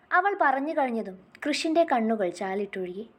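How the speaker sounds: usual speaking voice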